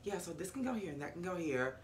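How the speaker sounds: quiet, unbothered imitation; calm. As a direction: say it softly, calmly busy with the task. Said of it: "just calmly standing there like".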